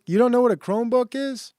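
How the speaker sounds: stunned that chat doesn't know; incredulous. Real, not acted. Chat asks what a Chromebook is.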